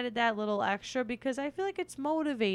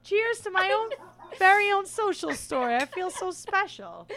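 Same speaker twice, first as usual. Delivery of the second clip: delighted; 9 semitones higher, 6 dB louder than usual. Real, not acted. Thanking friend for story.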